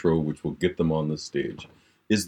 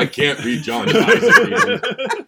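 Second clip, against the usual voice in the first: laughing protest; 6 dB louder than usual. Real, not acted. Protesting a scary book recommendation.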